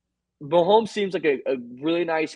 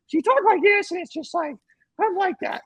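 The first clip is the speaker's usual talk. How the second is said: very high, squeaky mocking imitation; teasing high falsetto take-off of the quarterback; Mahomes voice impression. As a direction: squeak it out to mock his voice. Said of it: "telling people I'm like that with that voice".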